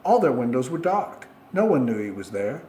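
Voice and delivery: usual speaking voice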